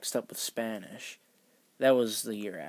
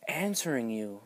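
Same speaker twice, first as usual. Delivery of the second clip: higher, taken-aback protest; mock defensive; 5 semitones higher than usual. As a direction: act innocent and wounded. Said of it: "she's got all sassy with me".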